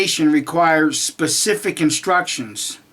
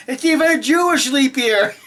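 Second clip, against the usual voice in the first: laughing; 8 semitones higher than usual. Real, not acted. Laughing at Watchtower.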